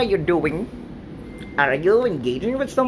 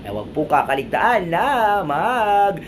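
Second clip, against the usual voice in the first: lively livestream host pitch; upbeat game show hosting; 6 semitones lower than usual. Acted. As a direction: hype up the viewers as quiz host. Hosts game show segment.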